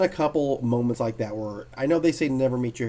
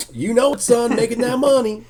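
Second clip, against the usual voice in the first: louder, higher boastful big-shot voice; imitating Neil; 10 semitones higher, 8 dB louder than usual. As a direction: crow it like a proud old pro. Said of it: "He's like".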